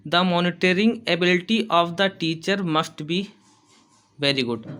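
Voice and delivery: slow, careful dictation pace, said twice; teacher dictating notes. Shown as a demonstration. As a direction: read it slowly so students can write. Teacher dictating an English point.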